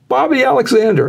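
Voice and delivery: high-pitched retold tearful answer; imitated crying. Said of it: "He's actually crying".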